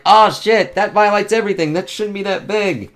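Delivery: high, loud panicked gush; hammed-up freak-out at the size; mock alarmed Tony. Tony reacting to the monster, mock panic. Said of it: "Tony's like".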